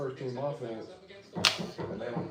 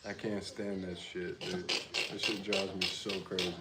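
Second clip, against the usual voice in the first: normal talking voice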